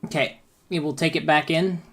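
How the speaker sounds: usual speaking voice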